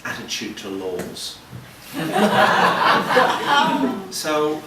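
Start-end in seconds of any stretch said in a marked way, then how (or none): 1.9-4.1 loud high-pitched laugh. Real, not acted. Comedian laughing about Irish laws. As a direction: burst out laughing.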